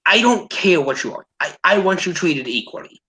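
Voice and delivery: normal talking voice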